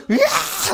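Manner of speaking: put-on high, loud tantrum scream out-shouting a child; wild scream. Shown as a demonstration. Parent out-screaming the crying child at the store, full tantrum howl. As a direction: let out a big howl like a toddler's tantrum. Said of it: "같이 둘은 어서 소리 지르세요".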